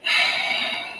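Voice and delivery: breathy outward sigh; audible sigh. A narrator's reading. John softening toward her, heavy sigh. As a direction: let out a long sigh. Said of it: "he sighed outwardly".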